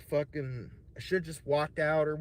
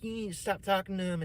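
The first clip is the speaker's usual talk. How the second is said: retold angry yelling; 7 semitones higher than usual. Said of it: "screaming at me".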